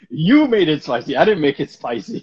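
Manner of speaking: loud, high, laughing through it; laughing protest. Retold or imitated. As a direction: protest while cracking up.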